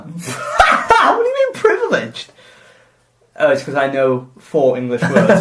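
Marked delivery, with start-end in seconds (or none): 0.1-2.3 laughing indignation. Real, not acted. Mock outrage at teasing.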